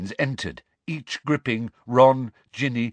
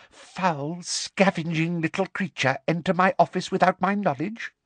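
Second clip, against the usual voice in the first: higher, controlled, scornful villainess voice; menacing schoolmistress; 7 semitones higher than usual. Acted. Teacher berates intruder in her office, menacingly.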